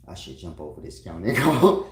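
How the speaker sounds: loud exasperated rising "go". Retold or imitated. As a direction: blurt it fed up. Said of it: "I said".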